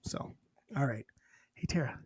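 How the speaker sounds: hushed whispered aside. Real, not acted. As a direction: drop to a whisper to wrap up. Whispered wrap-up.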